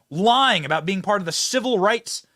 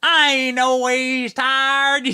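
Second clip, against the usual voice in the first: very slow, higher mocking Southern drawl; mock Southern preacher accent; 5 semitones higher, 4 dB louder, much slower than usual. Host replaying Hillary's line for viewers, slow mock Southern drawl. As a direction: drag out every word like a mock sermon. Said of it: "Such a good clip".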